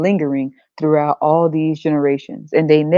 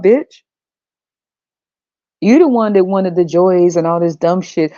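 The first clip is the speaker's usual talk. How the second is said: ordinary delivery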